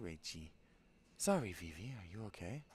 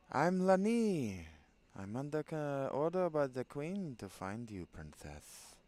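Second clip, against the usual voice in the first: put-on Castellano Spanish accent; 5 semitones higher, 5 dB louder than usual. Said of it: "give her something like a Castellano".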